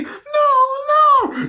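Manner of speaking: high-pitched hammed-up protest; imitated high wail of refusal; mock pleading. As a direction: cry out in mock panic. Acting out the film's gangster scene.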